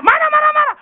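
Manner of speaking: very high, very loud mock outburst; retold angry denial. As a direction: yell the denial, comically overdone. (meniru bentakan menyangkal, sangat tinggi dan keras)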